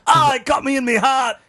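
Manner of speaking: high cry in mock Australian; worst Australian accent. Playing a man stung by a stingray, bad Australian accent. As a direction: yelp it in a terrible Australian accent. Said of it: "worst Australian accent ever".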